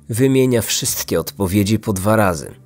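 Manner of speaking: ordinary delivery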